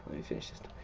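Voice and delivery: breathy whisper to himself; whispered aside. Real, not acted. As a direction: say it under your breath, barely voiced.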